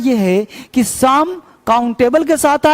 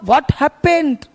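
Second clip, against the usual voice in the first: 1 slow model phrase; much slower than usual. Shown as a demonstration. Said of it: "तो क्या हुआ?".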